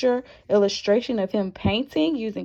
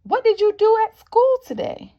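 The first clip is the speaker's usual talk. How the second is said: high, quick, cheery mom voice; mom asking; 10 semitones higher, 4 dB louder, faster than usual. Acted. Pete's mom greets him off the bus, in a high, cheery mother's voice. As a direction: ask brightly like a mother at the bus stop. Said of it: "Pete's mom asked him".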